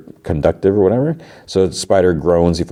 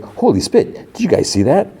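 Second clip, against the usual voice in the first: high-pitched mock amazement; excited crowd member, imitated without shouting; amazed onlooker, retold; 11 semitones higher than usual. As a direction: marvel like a stunned bystander, voice jumping up. Bystander amazed at the fight.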